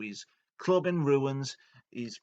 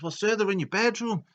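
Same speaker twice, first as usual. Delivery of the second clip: high, loud anxious servant; alarmed underling; 11 semitones higher, 7 dB louder than usual. Retold or imitated. Domo warning boss about intruders, alarmed high voice. Underling breaking bad news loudly.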